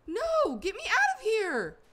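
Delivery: very high, loud desperate cry; real panicked shriek; panicked scream. Real, not acted. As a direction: scream to be let out. Stuck in hole, repeated plea.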